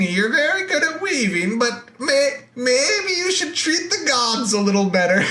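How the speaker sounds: high, pleading goddess voice; high Athena voice. Retold or imitated. Teacher voicing Athena warning Arachne, high and pleading. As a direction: plead with her in a lifted, far-off voice.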